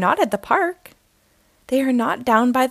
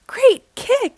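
high, loud cheering yell; 13 semitones higher, 6 dB louder than usual. A narrator's reading. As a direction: cheer it out like kids at a game. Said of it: "the Cubs yell".

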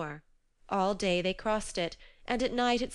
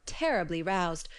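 usual speaking voice

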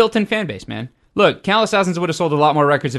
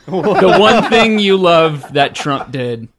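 slow, laughing through the words; 4 semitones higher, 7 dB louder, much slower than usual. Real, not acted. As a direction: giggle while you say it.

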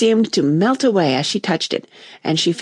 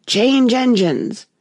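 higher, slow hoarse call; 6 semitones higher, much slower than usual. A narrator's reading. Passenger in the carriage announces, slow and hoarse. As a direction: drag the words out like a tired conductor. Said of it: "a hoarse voice spoke next".

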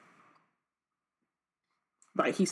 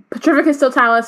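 higher, louder narrated yell; yelled spell; 6 semitones higher, 5 dB louder than usual. A narrator's reading. Harry fights off the Inferi with a spell, yelled. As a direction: cast the spell with a raised voice. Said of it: "he yelled".

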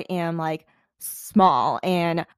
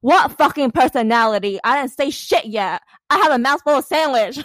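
louder, higher, exasperated; indignant retort; 5 semitones higher, 5 dB louder than usual. Retold or imitated. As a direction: throw the question back in disbelief. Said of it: "And I'm like".

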